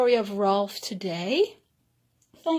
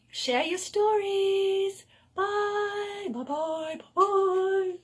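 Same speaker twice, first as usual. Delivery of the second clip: playful sing-song goodbye; 9 semitones higher, slower than usual. Pretend. Playful farewell.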